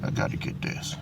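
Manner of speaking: whispered, no voice at all. Real, not acted. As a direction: breathe "I gotta get this" as a pure whisper. Repeats it to himself.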